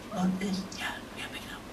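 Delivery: retold whisper, breathy and hushed. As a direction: whisper it like you're hiding from the camels. Hushed exchange while stalking camels.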